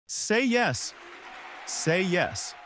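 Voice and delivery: high, pushy chant from the crowd; crowd shouting. Acted. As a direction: egg her on from the crowd. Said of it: "Someone was shouting".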